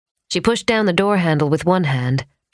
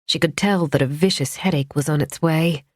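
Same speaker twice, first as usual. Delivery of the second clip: plain reading voice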